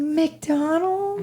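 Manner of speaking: high, thrilled squeal of a question; imitating excitement. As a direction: mimic someone delighted by a treat. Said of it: "She was so excited".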